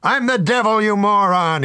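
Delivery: high, loud, insulted outburst; exasperated devil. Acted. Devil fed up with the drinker at the bar, loud and insulted. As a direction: snap it at the fool who ignores you.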